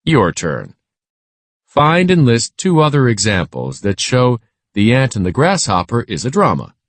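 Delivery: usual speaking voice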